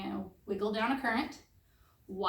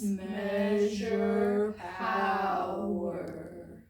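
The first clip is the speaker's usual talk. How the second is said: very slow, stretched-out words; really slow; much slower than usual. Shown as a demonstration. Teacher drilling electricity units with students, really slowly. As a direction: draw every word right out. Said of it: "let's say it really slowly".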